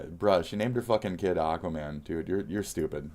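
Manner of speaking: ordinary talk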